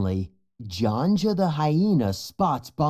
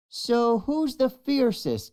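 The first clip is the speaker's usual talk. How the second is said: Fuli's voice; 11 semitones higher than usual. A narrator's reading. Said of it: "Fuli wants to know".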